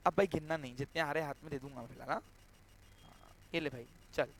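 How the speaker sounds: low, soft role-played remark; low acted aside. Buyer handing Sahil the cash uncounted, low acted aside. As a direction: drop pitch and volume for a casual handover.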